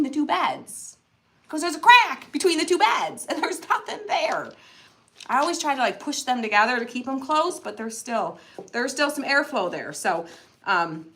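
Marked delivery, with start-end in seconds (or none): none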